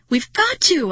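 high, loud insistence; frantic high-pitched shout; shrieking. A narrator's reading. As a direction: shriek it in desperation. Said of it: "I shrieked".

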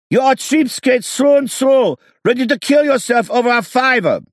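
very high, loud shriek; shrill haggling yell; falsetto shouting. A narrator's reading. Drivers trading insults loudly.

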